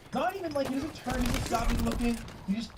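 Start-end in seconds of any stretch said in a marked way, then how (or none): none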